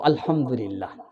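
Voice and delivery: soft, low, slow daughter's answer; hushed, unhurried reply; demure reply. Acted. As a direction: answer modestly under your breath. Daughter's demure answer about in-laws.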